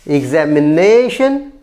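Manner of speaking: examination said slowly, NA long and rising; slow down and punch NA in examination; stress on fourth syllable. Shown as a demonstration. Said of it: "Stress on the fourth syllable". (examination के चौथे syllable na पर ज़ोर)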